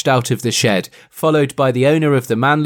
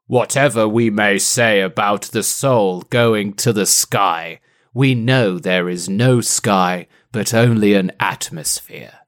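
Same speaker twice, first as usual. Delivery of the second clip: low, put-on manly voice; 5 semitones lower than usual. Host reads the quote of the season, deep manly voice. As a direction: drop the pitch and pronounce it like a manly philosopher. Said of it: "this is from the manly voice".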